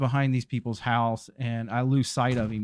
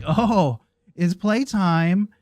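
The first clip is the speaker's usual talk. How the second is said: higher, louder, slow and delighted; puppy thrilled the chase is on; gleeful dog voice; 6 semitones higher, 6 dB louder, much slower than usual. Acted. Dog sees his owner running, higher gleeful slow playtime.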